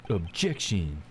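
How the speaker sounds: low, dramatic courtroom objection; deep objection voice. Acted. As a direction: drop the pitch and declare it. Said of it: "My objection voice".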